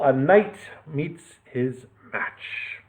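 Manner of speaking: slow, soft, drawn-out title. A narrator's reading. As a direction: let the title hang slowly. Title reread slowly.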